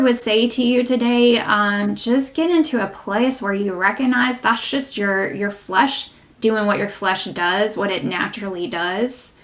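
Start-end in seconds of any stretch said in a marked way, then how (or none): none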